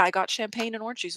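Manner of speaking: ordinary delivery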